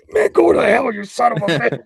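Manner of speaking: high, loud mock-angry yell; high shouted insult, half laughing; shouted curse. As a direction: bellow it back like a boxer joking around. Boxer laughingly cursing him out.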